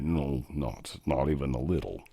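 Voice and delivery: low, soft Sean Connery Scottish answer; reply as Sean Connery in a deep Scottish murmur. Retold or imitated. Answering himself as Sean Connery in Scottish tones. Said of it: "It sounded like Sean Connery".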